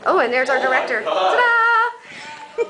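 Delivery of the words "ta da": excited. Real, not acted. Presenting the director.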